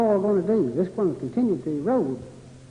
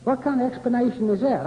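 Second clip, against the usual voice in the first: ordinary delivery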